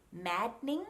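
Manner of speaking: "maddening" in two syllables, mad-ning; "maddening" without a middle vowel; silent D. Shown as a demonstration. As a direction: hold the D of "maddening" straight into N. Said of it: "other examples of the silent D".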